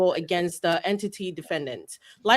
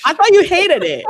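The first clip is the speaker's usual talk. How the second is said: mock indignant; 9 semitones higher, 10 dB louder than usual. Teasing friends who hated accent.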